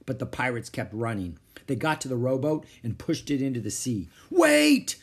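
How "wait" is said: very high, loud yell; desperate cry. A narrator's reading. Captain as crew pushes off rowboat, crying out. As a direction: yell to be waited for. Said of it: "cried Captain Bones".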